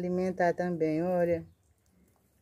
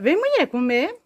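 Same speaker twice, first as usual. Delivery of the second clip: high, louder call to a pet; 10 semitones higher, 6 dB louder than usual. Real, not acted. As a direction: call the animal over, high. Calling the tortoise to come.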